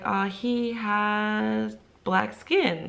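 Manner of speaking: slow, stretched-out hesitant answer; reluctant student. Retold or imitated. Student reluctantly answering her teacher, hesitant. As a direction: imitate a child dragging out an awkward answer.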